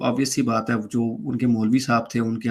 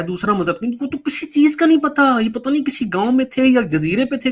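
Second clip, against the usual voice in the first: exasperated father; 9 semitones higher than usual. Retold or imitated. Father berates the preachers about the converts, high-pitched exasperated scolding. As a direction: scoff at them, voice pitched up high.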